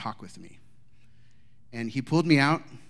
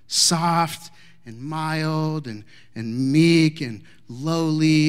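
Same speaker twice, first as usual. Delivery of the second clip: very slow, savoring each word; 3 semitones higher, 5 dB louder, much slower than usual. Shown as a demonstration. Preacher dwelling on gentleness words, slow and drawn.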